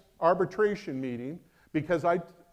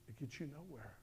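much softer, hushed echo of the line; quiet repeat; 3 semitones lower, 21 dB quieter than usual. Shown as a demonstration. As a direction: drop it to near silence.

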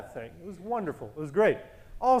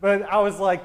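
ordinary talk, nothing marked

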